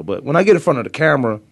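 ordinary talk

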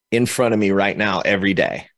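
ordinary talk, nothing marked

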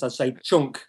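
"Chunk" with a short northern "u", like "Chook"; northern English accent. Shown as a demonstration. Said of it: "if I use my northern accent".

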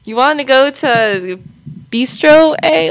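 comically bad Canadian accent; attempted Canadian accent. Shown as a demonstration. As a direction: ham up a slow Canadian accent with a laugh. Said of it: "I just put that, like, the end".

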